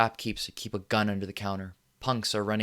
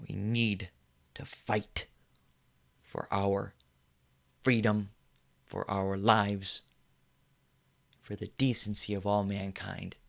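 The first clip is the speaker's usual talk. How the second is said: solemn resolve; 3 dB quieter, slower than usual. Acted. Slow solemn call to fight.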